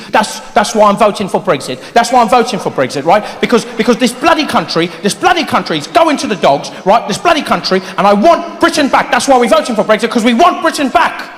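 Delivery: put-on ranting in a British accent; British Brexit voter. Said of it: "I saw people on the news talking to the B B C".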